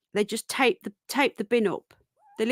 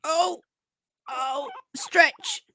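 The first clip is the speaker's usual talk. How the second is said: high delighted shout; excited cry; 7 semitones higher, much slower than usual. Real, not acted. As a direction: burst out as the rabbit stretches. Excited as pet stretches.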